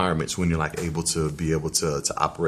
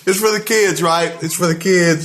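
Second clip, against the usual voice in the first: laughing; 11 semitones higher, 7 dB louder than usual. Real, not acted. Laughing at the spicy wings challenge.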